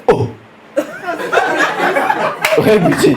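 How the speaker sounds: stretched, amazed "Oh, Rebuti"; surprised recognition. Retold or imitated. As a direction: act stunned to see him. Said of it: "Il fait".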